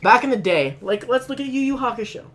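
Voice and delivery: loud, high worked-up rant; heated rant. Real, not acted. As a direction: argue the point hotly.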